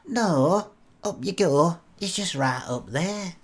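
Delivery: high-pitched put-on Blackpool woman's voice; Blackpool accent. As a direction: urge him on in a Blackpool accent. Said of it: "is that a Blackpool accent you've got".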